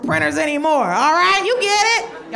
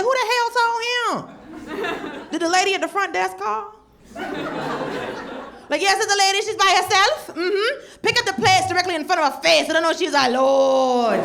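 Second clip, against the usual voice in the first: higher, louder laughing gossip on the phone; gossiping front desk lady; 7 semitones higher, 4 dB louder than usual. Retold or imitated. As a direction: dish the news breathlessly. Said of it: "I said".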